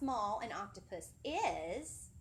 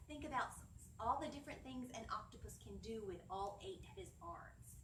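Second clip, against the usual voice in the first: ordinary delivery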